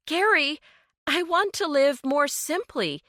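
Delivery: distant, muffled voice; calling from the next room; muffled from another room. A narrator's reading. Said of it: "Kinsley declared from the living room".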